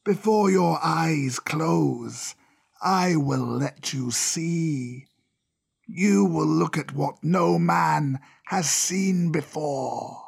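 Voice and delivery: very slow, drawn-out serpent voice; proud old cobra. Acted. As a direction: unveil a secret with long, stretched words. Cobra figure in the story.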